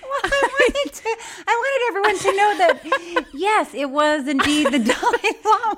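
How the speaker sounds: high, loud words through real laughter; cracking up. Real, not acted. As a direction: defend yourself while helpless with laughter. Defends clip choice while cracking up.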